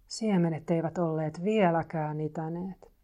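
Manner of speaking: usual speaking voice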